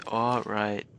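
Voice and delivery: ordinary talk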